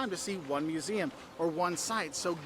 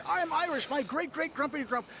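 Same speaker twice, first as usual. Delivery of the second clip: higher-pitched mock boast; proud Irish-American tourist; 7 semitones higher than usual. Irish-American tourist announcing family lineage in Ireland, mock-proud and high. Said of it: "they're so proud".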